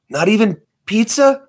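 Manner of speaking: high, plaintive little voice; high pleading. Acted. Asking the strict one for pizza, high and plaintive.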